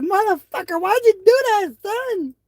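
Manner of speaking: very high mock mama cry; imitating mama. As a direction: squeal it like an angry mom. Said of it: "She's like".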